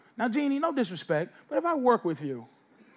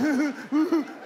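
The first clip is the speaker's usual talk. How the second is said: higher, put-on chuckle; imitated obliging laugh; 4 semitones higher than usual. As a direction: fake a laugh to go along. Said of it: "and he's just like".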